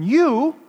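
"You" punched high and loud; "You" as a booming emphatic opener. Shown as a demonstration. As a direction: hit "You" hard, ringing out. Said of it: "begins with that emphatic pronoun".

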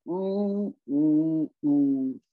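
slow drawn-out mopey oh sounds; mopey Eeyore groan. Retold or imitated. Voicing Eeyore for the viewers, mopey groan. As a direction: groan like a sad donkey. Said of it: "it's like".

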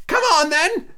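very high-pitched royal yell; roaring Queen. A narrator's reading. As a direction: bellow the order in a high voice. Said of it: "roared the Queen".

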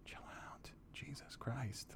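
whispered calming plea; slow whisper. Retold or imitated. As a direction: hush him like a friend. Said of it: "just went over there and said".

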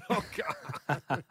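helpless, amused exclamation; laughing groan. Real, not acted. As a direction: laugh through the words. Laughing at the listener's answer.

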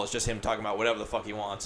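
usual speaking voice

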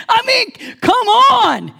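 high, loud exasperated come on; half-laughing exasperation. Real, not acted. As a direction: throw up your hands in disbelief. Exasperated outburst at disciples.

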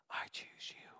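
breathy whispered words. Acted. Pastor voicing Jesus to the church, whispered. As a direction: whisper it tenderly, like a secret.

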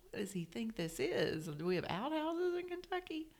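retold disbelief climbing high; mock-indignant, pitch soaring at the end; incredulous. Retells her indignant reaction.

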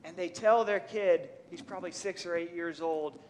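normal talking voice

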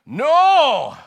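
put-on huge, high Scottish-accented shout of refusal. Old Scot shouting down the preacher in a Scottish accent. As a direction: bellow the no like an outraged Scot. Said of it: "I'm gonna do a Scottish accent here".

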